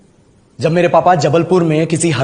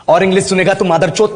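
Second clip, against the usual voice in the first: ordinary delivery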